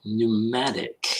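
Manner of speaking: "Pneumatic" drawn out slowly, each syllable separate; slow careful word. Shown as a demonstration. Host slowly sounding out a word his cohost just read. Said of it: "looking up pneumatic pedals".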